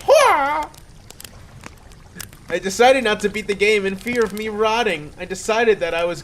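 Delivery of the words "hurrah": very high, loud cheer; screamed cheer. Acted. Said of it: "I screamed".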